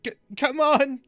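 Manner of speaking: high-pitched frantic yell. Acted. Creep stumbles out of the illusions, yelling. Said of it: "just starts yelling".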